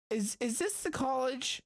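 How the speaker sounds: high-voiced question to a stranger; tentative newcomer. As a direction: ask it in a high, hesitant voice. Player's character addresses mascot.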